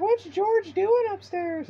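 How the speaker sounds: very high, slow falsetto mom; mom's voice. Retold or imitated. Mock high mom voice to the narrator. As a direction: squeak it out like his mom asking. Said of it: "So his mom was like".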